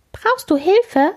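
small ladybird offering help, high and slow; tiny ladybird voice. Acted. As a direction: squeak it like a tiny beetle. Ladybirds speak.

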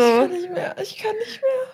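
high, helpless laughing; crying with laughter. Real, not acted. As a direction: laugh till you cry. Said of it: "Mir laufen die Tränen".

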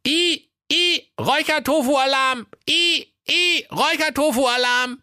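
high beeping alarm voice; smoke alarm voice. Acted. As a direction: squeal like a smoke detector. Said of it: "Und der Rauchmelder sprach".

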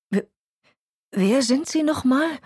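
baffled stammer, voice lifted. A narrator's reading. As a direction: stumble into a bewildered question. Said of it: "stammelte Walter".

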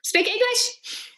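a hammed-up plea to speak properly; mock exasperated. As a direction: drag it out in mock despair. Retort to the accents.

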